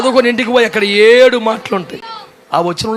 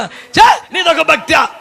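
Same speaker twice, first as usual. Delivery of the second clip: scornful scoff; 6 semitones higher, slower than usual. Acted. Scolding the timid believer.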